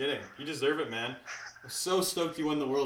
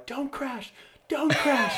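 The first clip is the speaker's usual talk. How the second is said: high mock yell, getting louder; retold yelling; 12 semitones higher, 5 dB louder, much slower than usual. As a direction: yell it like you're trackside. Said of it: "I was just yelling".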